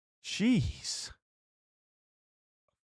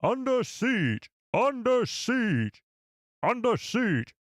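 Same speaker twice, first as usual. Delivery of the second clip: high, drawn-out newsreel announcer; Clone Wars narrator; 8 semitones higher, much slower than usual. Acted. Streamer practicing the show's opening for viewers, Clone Wars narrator impression. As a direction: announce it slowly, pitched up and dramatic. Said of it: "I'll do my impression".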